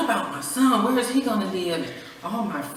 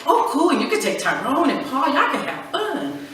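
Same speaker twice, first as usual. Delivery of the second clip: mock breezy; 8 semitones higher, 3 dB louder than usual. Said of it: "I was like".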